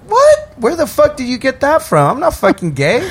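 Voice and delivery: high, indignant denial; mock outrage. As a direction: play shocked and offended. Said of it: "I'd be like".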